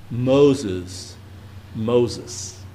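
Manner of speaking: God tenderly naming Moses, not booming; gentle God voice. Retold or imitated. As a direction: call him gently, protectively. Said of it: "God is protecting Moses".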